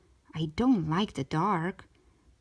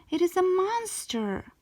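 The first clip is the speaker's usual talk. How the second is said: bawling; 11 semitones higher than usual. A narrator's reading. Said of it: "I bawled".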